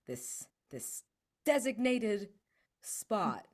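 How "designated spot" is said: higher, louder salesy pitch; forced enthusiasm. Acted. Player pitching to followers, forced excited cheer. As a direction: sell the plan with a big grin.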